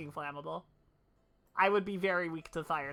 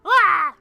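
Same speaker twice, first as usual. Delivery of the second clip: sudden high loud yell; jump scare yell; 12 semitones higher, 17 dB louder than usual. Acted. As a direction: leap out with a yell.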